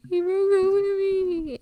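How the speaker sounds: high, loud, slow sob-choked words; crying. Retold or imitated. Daughter telling her mom about the breakup, imitating sobbing.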